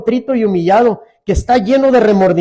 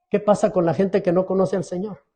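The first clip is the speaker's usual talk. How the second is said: ordinary delivery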